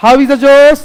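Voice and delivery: hype-man shout at students; rousing shout. Acted. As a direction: shout it to get the room going. Teacher rousing class, shouting.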